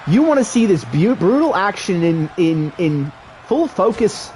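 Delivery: excited wrestling announcer; play-by-play commentary. Acted. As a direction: call the match like a ringside commentator.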